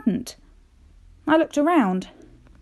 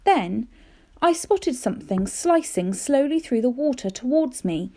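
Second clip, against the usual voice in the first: ordinary delivery, nothing marked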